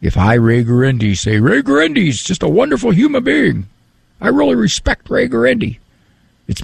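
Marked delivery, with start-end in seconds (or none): 1.4-5.8 high, hammed-up self-flattery; mock self-praise. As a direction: boast about yourself in jest.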